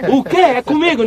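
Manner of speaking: a dead man jolted awake, bewildered; confused dead man. Acted. Mortos acordando confusos. (morto acordando assustado, mais agudo e alto)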